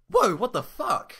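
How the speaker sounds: high, loud, startled cry; falling backward, crying out in alarm; cried out in alarm. A narrator's reading. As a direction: yelp it as your foot drops. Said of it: "I cried out in alarm".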